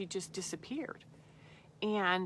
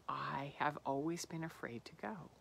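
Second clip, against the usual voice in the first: fearful whisper; 4 semitones lower, 7 dB quieter than usual. Retold or imitated. Inner voice facing deep fear.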